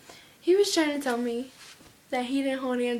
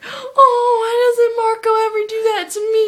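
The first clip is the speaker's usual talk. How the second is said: high, loud mock lament; Sabrina; 9 semitones higher, 11 dB louder than usual. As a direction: imitate a friend's envious wail. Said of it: "And then Sabrina was like".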